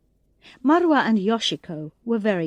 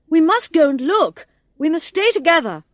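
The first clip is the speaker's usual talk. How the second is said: louder, firm group orders; rally the others, raised voice; urgent command; 6 semitones higher, 5 dB louder than usual. A narrator's reading. Thomas urges friends to search together, loud and firm.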